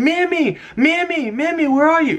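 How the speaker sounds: high calling out a dog's name. Retold or imitated. Walking the neighborhood hunting the dog, high calling out. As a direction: call out for the lost pet. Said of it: "We're screaming".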